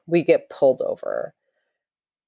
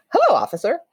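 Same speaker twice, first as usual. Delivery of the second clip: much higher, bright eager hello; chipper greeting; 10 semitones higher, faster than usual. Retold or imitated. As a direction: greet the cop like a cheery kid. Bounds out to greet cop.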